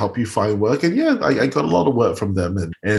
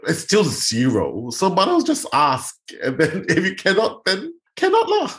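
rising higher and playful, with Singlish lah; breezy shrug; 10 semitones higher than usual. Retold or imitated. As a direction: toss it off like nothing to lose.

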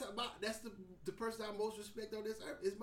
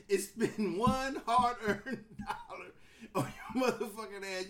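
ordinary talk

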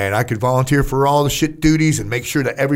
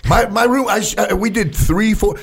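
ordinary talk

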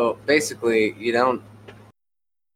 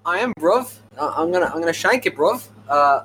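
high, cocky mock London street accent; London roadman accent; 6 semitones higher than usual. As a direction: play a tough London lad, hammed up. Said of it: "I feel like you're about to shank me".

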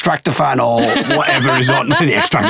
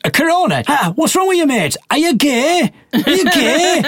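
much higher, jeering laddish mock voice; mocking stag lad. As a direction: ape the stag taunting his mate about the beer. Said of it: "And then he goes".

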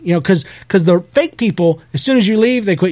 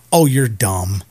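low, unimpressed put-down; unimpressed deadpan; 6 semitones lower, slower than usual. Retold or imitated. She finally sees the feather, deadpan. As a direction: imitate her not even flinching, lower pitch. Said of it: "she doesn't even flinch".

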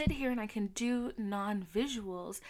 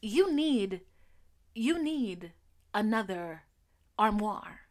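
louder, slow, halting pronouncement; imitating mom; 2 semitones higher, 8 dB louder, slower than usual. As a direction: deliver mom's odd news slowly. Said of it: "she literally goes".

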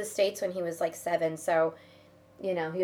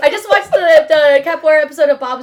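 excited; 7 semitones higher, 16 dB louder than usual. Real, not acted. Excited about show.

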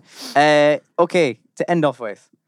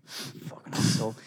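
muttered; 3 semitones lower, 11 dB quieter than usual. Real, not acted. Muttered aside.

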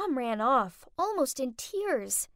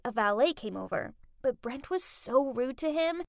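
usual speaking voice

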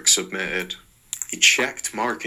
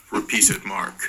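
ordinary talk, nothing marked

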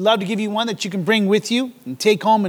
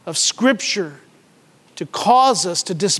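ordinary delivery

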